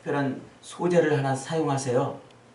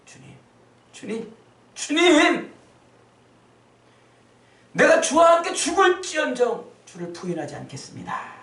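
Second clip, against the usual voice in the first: angry Peter; 13 semitones higher, 4 dB louder than usual. Acted. Peter, stung, crying out to Jesus in anger. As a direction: shout your hurt protest. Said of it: "승질이 났어요".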